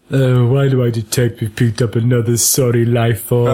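put-on stately film voice; mock actorly weariness; Obi-Wan. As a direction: deliver it as a weary, measured Jedi complaint. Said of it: "doesn't sound like Obi-Wan".

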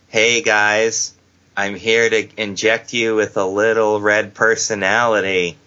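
low, monotone wrestler promo; mock Eva. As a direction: deliver it deadpan and low, dragging the last word.

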